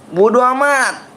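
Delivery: high snappy "bodo amat"; mock dismissive. Streamer brushing off chat, high dismissive jab.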